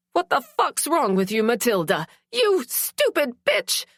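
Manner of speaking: high furious yell at Matilda; woman screaming at the attacker; angry shout. A narrator's reading. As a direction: shout her down in fury. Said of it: "a woman's voice shouted".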